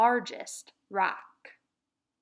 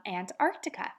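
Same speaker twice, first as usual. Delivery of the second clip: ordinary talk, nothing marked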